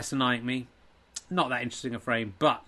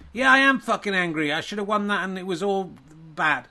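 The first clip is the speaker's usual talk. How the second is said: angry player; 3 semitones higher than usual. Acted. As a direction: sulk and fume about the loss. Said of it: "angry me thirty-two".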